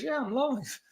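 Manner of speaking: sheepishly admitting it, up high; his own reply. Retold or imitated. Telling co-host how he answered mum, sheepish and high. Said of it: "I goes".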